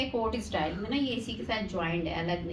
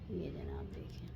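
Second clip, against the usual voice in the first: whisper; 16 dB quieter than usual. Real, not acted. Quiet aside while filming her look.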